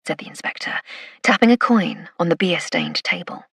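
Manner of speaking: plain reading voice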